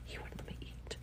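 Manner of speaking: hushed, disbelieving whisper; whispered repeat. Real, not acted. Repeating the complaint.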